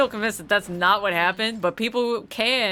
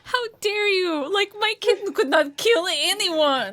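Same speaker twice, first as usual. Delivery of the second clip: much higher, whiny protest; indignant accused woman; 11 semitones higher than usual. Acted. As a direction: play the cornered suspect high.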